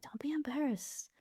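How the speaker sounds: soft reassuring whisper; whispered comfort to a guest. Retold or imitated. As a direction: hush them gently. Comforting embarrassed guest, quoted self.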